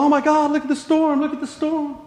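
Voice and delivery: high, loud panicked cry; mock panic over the storm; freaking out. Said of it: "all his disciples are freaking out".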